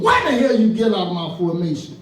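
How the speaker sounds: mock drill-sergeant bellow; acted-out barked challenge; drill sergeant shout. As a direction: shout it like a sergeant dressing down a recruit. Drill sergeant barks at private.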